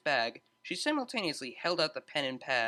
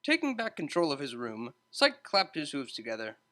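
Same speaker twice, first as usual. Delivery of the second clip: ordinary delivery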